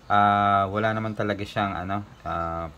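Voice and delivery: ordinary delivery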